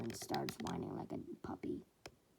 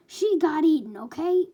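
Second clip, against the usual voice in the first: loud, higher, fed-up outburst; exasperated snap; 7 semitones higher, 12 dB louder than usual. Acted. Shigaraki snaps at Twice to stop his fretting, loud. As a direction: cut him off sharply.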